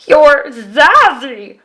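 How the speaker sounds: slow, loud praising creature; grinning Yeti. Acted. Yeti turning to the girl with a grin. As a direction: beam the compliment. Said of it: "said the Yeti".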